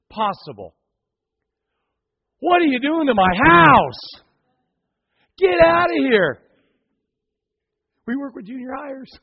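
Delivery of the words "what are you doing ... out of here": loud furious yell at the intruders; angry homeowner. Acted. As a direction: bellow it like your roof was just torn open. Homeowner yelling at roof diggers.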